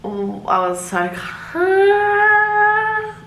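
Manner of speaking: high, very slow mock-serene; high drawn-out sarcastic calm. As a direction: drag it out ironically. Ironic aside with music.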